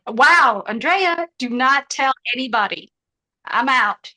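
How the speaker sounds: loud, high, scandalized wow; shocked outcry. Real, not acted. Streamer scandalized by a viewer's chat message, loud outcry. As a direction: cry out at what they typed.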